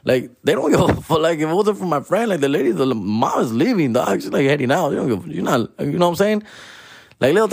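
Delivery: usual speaking voice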